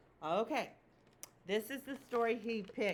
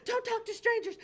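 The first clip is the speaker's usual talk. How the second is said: much higher, louder chicken warning; urgent warning; 12 semitones higher, 6 dB louder than usual. Acted. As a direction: shout it like a worried little chicken. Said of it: "she said".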